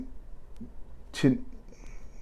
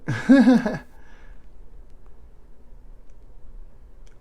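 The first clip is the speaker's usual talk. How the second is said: high breathy laugh; 10 semitones higher than usual. Real, not acted. Laughs after joke request.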